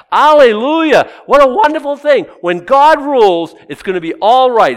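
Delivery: loud, high hallelujah praise; preacher rejoicing loud and high; jubilant. Real, not acted. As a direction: shout it out with joy. Celebrating God's rule in sermon.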